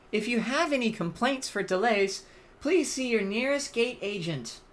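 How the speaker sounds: high, polished PA announcement; airport announcer. Pretend. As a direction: page passengers over the gate speaker. Gate agent announcement again.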